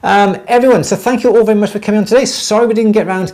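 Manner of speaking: high-pitched, fast sign-off; high and rushed. Shown as a demonstration. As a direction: rattle it off up high. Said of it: "rushed high sign-off, then redone".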